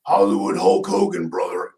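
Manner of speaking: low gruff "brother" drop; Hulk Hogan. Retold or imitated. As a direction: growl it like a wrestler. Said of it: "how does he say it?".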